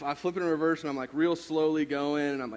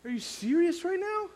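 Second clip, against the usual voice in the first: much higher, hammed-up disbelieving scold; mock exasperation; 7 semitones higher than usual. Speaker to teen who dented his truck, mock exasperated. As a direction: play the fed-up dad for laughs. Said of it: "I'd be like".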